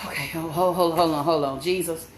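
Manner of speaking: low, hushed stalling; nervous self-steadying. Real, not acted. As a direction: murmur it low to steady yourself. Steeling herself to face the bird.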